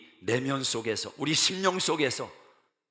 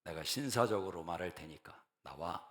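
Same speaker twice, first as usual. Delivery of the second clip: softer, mockingly gentlemanly request; courteous, softened mock request; mock polite; 7 semitones lower, 8 dB quieter than usual. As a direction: play the polite exorcist to show it's wrong. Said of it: "인격적으로 ... 상대하지 않습니다".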